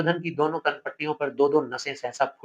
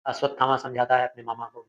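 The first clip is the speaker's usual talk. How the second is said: ordinary talk, nothing marked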